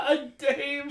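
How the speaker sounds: high, squealed through laughter; helpless laughter. Real, not acted. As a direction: repeat it while cracking up. Laughing at co-host's word.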